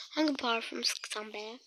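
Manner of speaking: ordinary talk, nothing marked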